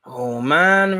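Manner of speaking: ordinary talk, nothing marked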